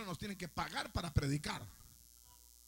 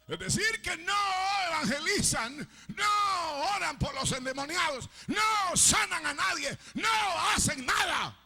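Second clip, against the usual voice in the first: loud, high, slowing hammer blows; shouted pulpit rebuke; fiery rebuking sermon; 7 semitones higher, 6 dB louder than usual. Acted. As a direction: pound each phrase at the congregation.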